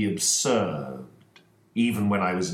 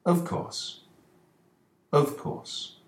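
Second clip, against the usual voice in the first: higher, near-whispered "of course"; 9 semitones higher, slower than usual. Acted. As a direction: murmur it as it dawns on you. Holmes realizing.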